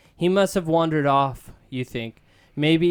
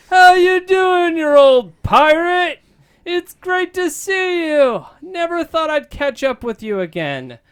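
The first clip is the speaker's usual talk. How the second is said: hammed-up Lando; 11 dB louder than usual. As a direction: ham up a big smooth charmer greeting an old pal. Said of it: "do your Lando voice".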